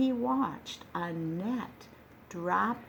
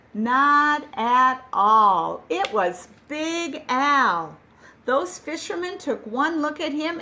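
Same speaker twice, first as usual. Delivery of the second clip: dramatic reveal; 5 semitones higher, 6 dB louder than usual. A narrator's reading. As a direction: announce it with a flourish. Surprise reveal in story.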